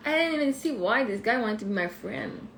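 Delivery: ordinary talk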